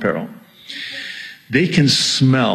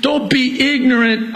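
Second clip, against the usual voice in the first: very high, urgent preacher's yell; impassioned pulpit yell; 13 semitones higher than usual. Real, not acted. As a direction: cry the warning out at high pitch. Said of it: "I'm sorry, I'm yelling".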